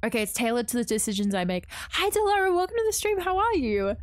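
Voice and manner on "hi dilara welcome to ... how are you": high-pitched sing-out welcome; cheery greeting. Real, not acted. Streamer welcomes a viewer to the stream, high cheery greeting.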